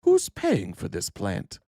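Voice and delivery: slow, ominous fearmonger voice; ominous question. Retold or imitated. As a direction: play it menacing. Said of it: "ask an ominous question".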